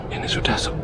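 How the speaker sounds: hushed, cautious whisper. Real, not acted. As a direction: breathe the words out. Whispering inside the abandoned house.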